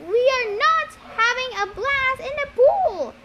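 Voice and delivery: high, loud chanted yell; yelling in unison. A narrator's reading. Zombie tourist kids in the pool, yelling together. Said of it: "the kids yelled in unison".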